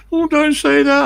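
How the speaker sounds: very high, loud pleading; hammed-up shrieking plea of the cursed friend; begging wail. Friend begs after being cursed.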